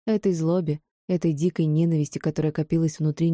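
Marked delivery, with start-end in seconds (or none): none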